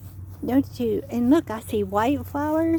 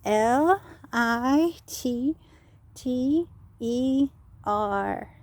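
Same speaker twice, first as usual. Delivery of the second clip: spelling out; much slower than usual. Shown as a demonstration. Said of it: "Litter is spelled".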